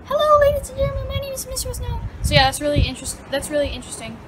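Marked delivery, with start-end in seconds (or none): 0.0-2.0 mocking little-kid voice, pitched way up; very high, cutesy impression of your old intro. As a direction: mimic your younger self in a tiny, high squeak. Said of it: "my cute little high-pitched voice".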